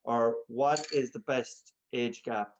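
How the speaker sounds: ordinary talk, nothing marked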